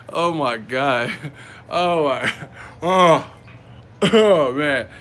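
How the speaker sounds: breaking up laughing, loud groaned exclamations; too tickled to do the bit; real laughing fit, words dragged out; helpless laughter. Real, not acted. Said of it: "I can't even do it".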